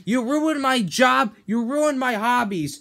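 blaming SpongeBob for everything; angry blame. Acted. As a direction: build to a yell at the end.

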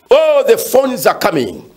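ordinary delivery

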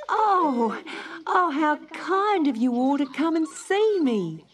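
put-on a little girl thrilled by her visitors; Dot's high glad cry. A narrator's reading. Dot greets the animals, high and delighted. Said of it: "cried Dot".

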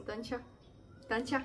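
ordinary talk, nothing marked

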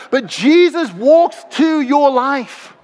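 usual speaking voice

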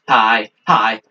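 ordinary talk

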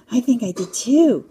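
mildly frightened, trembling thief; quivery voice. A narrator's reading. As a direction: agree nervously with a wobble. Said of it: "said Max in a quivery voice".

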